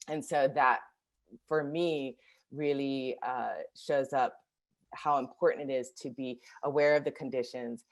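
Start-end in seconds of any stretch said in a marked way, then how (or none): none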